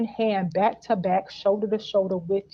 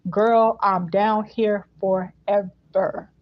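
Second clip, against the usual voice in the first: very slow, with "forever" drawn out; stress on forever; much slower than usual. Retold or imitated. Pledging to his girl, slow stress on forever. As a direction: stretch "forever" right out. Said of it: "emphasis on forever".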